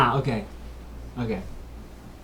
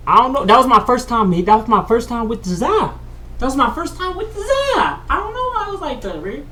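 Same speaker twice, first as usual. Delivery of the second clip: loud and higher, getting carried away; hyped up and pitching higher; rising excited outburst; 5 semitones higher, 5 dB louder than usual. Real, not acted. Excited retelling, voice jumps up.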